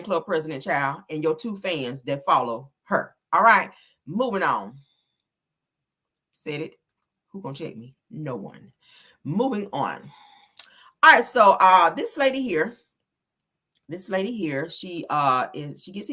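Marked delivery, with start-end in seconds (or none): 6.3-10.0 smug quiet boast. Real, not acted.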